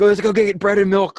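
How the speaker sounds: high, loud mock panic; panicky shopper. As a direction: squeal it like a frantic shopper. Mocking panicky snow-day shoppers.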